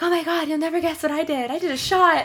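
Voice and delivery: high, bright excited squeal; all excited. Retold or imitated. Telling her best friend about the shot, excited squeal. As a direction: gush it like big news to a best friend. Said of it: "Like, all excited".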